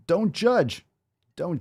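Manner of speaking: "Don't judge" jumped up high, sing-song; mocking high don't judge. Shown as a demonstration. Coach mocking the viewer's plea, high sing-song. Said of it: "repeated don't judge".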